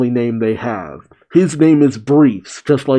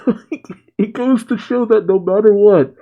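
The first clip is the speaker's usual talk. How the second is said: higher voice breaking with giggles; laughing while talking; 8 semitones higher than usual. Real, not acted. Narrator finds the recurring enemy funny, laughing. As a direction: push the point out through laughter. Amused remark through laughter.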